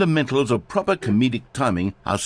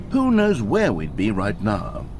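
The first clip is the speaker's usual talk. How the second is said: ordinary delivery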